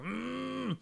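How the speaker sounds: muffled high squeal with mouth shut; underwater scream. Shown as a demonstration. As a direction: imitate screaming into the water. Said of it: "So it probably sounded like".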